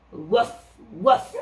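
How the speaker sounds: mild imitated dog bark; dog woof. Dog barks in story.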